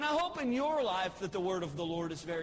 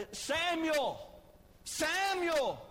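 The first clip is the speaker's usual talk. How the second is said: higher, calling out from afar; voice of the Lord calling; 5 semitones higher, much slower than usual. Acted. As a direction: call the boy's name like a distant voice. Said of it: "and the Lord says".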